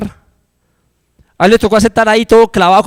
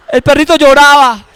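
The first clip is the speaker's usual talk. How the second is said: louder storyteller's lament; dramatic telling; 7 semitones higher, 6 dB louder than usual. A narrator's reading. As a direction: wring pathos from the dog's crying. Climax of sad dog story.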